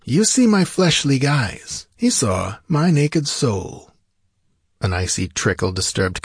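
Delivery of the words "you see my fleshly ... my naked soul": slow, higher-pitched riddle; Peleus, cryptic. A narrator's reading. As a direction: draw the words out mysteriously. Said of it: "answered Peleus cryptically".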